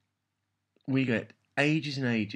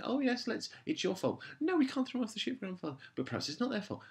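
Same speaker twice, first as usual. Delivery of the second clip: high, quick mock-girlish whine; high, fast parody of a dithering girl; mocking Susan; 12 semitones higher than usual. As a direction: lampoon her flip-flopping in a high, fast voice. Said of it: "Susan swinging between".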